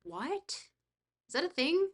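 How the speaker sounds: surprised. Real, not acted. Reacting to gift.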